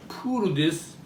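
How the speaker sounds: ordinary talk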